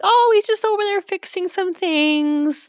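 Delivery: lightly mocking high voice; breezy, falsely casual and high; high singsong brush-off; airy high mimic. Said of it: "she's like".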